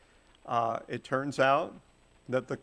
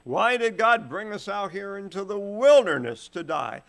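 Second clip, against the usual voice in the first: hammed-up grumbling lament, higher and louder; mock whining; 7 semitones higher, 6 dB louder than usual. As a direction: imitate the complaining generation, half-mocking. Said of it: "that always said".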